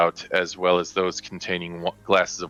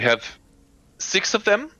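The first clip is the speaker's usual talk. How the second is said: high, busy lady of the house giving orders; bustling hostess, pitch jumping up; fussy noblewoman hostess; 5 semitones higher than usual. Acted. DM voices Lady Petrovna.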